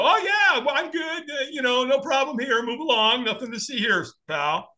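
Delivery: very high, breezy fake reassurance; lying that all's fine. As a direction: overdo pretending you are okay. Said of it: "we lie".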